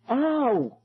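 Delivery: put-on a girl hurt by sudden light; a girl yelps as the flame flares; girl's cry of pain. A narrator's reading. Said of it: "a girl cried".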